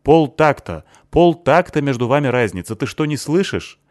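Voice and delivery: loud, high furious yelling; angry shout. A narrator's reading. Girl yelling at the bassist over his timing, furious. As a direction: yell it at him in frustration.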